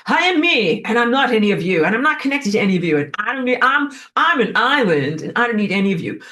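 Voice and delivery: tossing off the claim that nobody is needed; mock punk individualist. Punk cell sneering at the body, mock defiant. Punks' mock declaration of independence.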